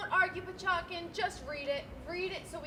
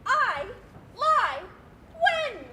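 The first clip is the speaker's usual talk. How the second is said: loud, much higher, indignant; outraged disbelief; 7 semitones higher, 10 dB louder, much slower than usual. Acted. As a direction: fling it back, offended. Outraged at being called liar.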